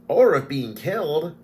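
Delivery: high loud cheerful quip; cheeky kender. Acted. Tasselhoff adding to Tanis's warning, high and cheeky. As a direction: toss it in brightly.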